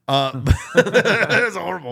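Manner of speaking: real laughter breaking into the words; laughing. Real, not acted. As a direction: crack up at your own story. Laughs at his own story.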